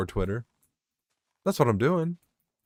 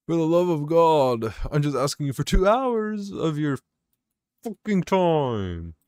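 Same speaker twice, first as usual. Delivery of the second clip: high, slow, drawn-out exasperated begging; exasperated plea; 7 semitones higher than usual. Real, not acted. Worker begging lazy coworkers for two hours, exasperated. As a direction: stretch it out like you are at the end of your rope.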